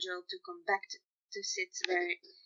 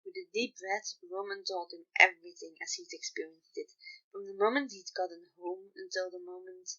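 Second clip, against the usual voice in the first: plain reading voice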